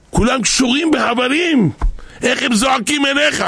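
high, urgent appeal; impassioned pleading. Acted. Angels appeal to God for the crying children, impassioned pleading.

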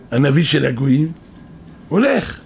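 usual speaking voice

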